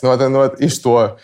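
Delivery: ordinary delivery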